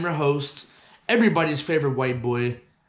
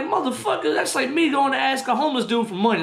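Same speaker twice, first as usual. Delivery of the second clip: indignant high rant; 10 semitones higher than usual. Retold or imitated. Retold angry comeback.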